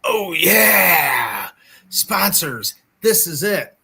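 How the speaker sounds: a touch of a big-voiced announcer; mock announcer voiceover. Host teasing co-host, mocks a voiceover announcer read. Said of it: "Mike Starr will do the voiceover".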